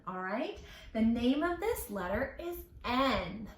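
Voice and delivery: ordinary delivery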